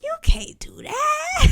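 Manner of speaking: high, whispered hammed-up cry; hiss-shout it in mock despair. Second take, shout-whisper.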